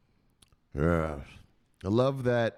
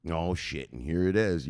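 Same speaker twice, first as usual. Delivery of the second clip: ordinary talk, nothing marked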